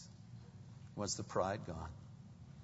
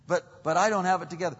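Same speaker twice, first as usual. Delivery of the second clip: higher, hurried stammered objection; protesting; 6 semitones higher, 8 dB louder, much faster than usual. Acted. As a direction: burst in with a doubter's quick protest. Acts protesting believer answering God.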